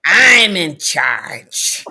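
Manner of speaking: long, drawn-out declaration, loud at the start; mock-commanding and stretched way out, falling at the end; father in charge. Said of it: "What did, what did he say".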